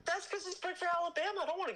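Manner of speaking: mocking high voice. Mocking Alabama.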